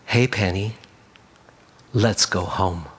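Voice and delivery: very low, soft, calming voice; gentle. A narrator's reading. Shepherd finding the lost lamb in the dark, gently. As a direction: murmur it tenderly, with a pause after the name. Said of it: "A familiar voice".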